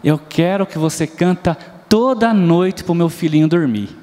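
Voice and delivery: normal talking voice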